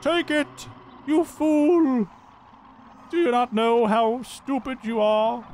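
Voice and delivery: high-pitched, hammed-up scolding; drawn-out, high mock outrage; mock yelling. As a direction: imitate the game berating the player. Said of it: "is yelling at me right now".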